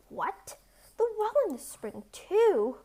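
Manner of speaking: a light growl, pitch jumping high on too; mock-gruff disbelief; giant growling. A narrator's reading. Giant muttering into his beard at the boast. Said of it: "growled the giant in his beard".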